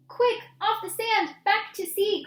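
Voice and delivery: high, loud urgent call; urgent cry. Acted. Snail crying out to the stranded whale, urgent and loud. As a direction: shout it up high to get the whale moving. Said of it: "cried the snail".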